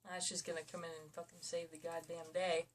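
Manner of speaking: ordinary talk, nothing marked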